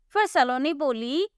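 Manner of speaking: plain reading voice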